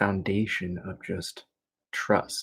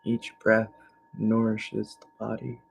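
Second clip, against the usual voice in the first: guided meditation; 3 semitones higher than usual. Real, not acted. Teacher shifting attention to navel and breath, calm meditation voice. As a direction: guide them calmly through each breath. Leads evening relaxation meditation.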